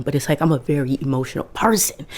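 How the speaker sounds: usual speaking voice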